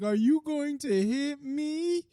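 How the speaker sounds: high, slow whining mimicry of a scared bully; squeaky, drawn-out mocking whine; mock whiny coward. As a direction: make him sound like a sniveling coward. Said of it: "He's like".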